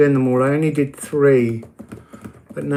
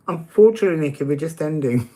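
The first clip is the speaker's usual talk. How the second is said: ordinary talk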